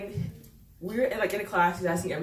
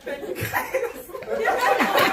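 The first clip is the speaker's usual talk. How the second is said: laughing thanks; 6 semitones higher, 5 dB louder, much slower than usual. Real, not acted.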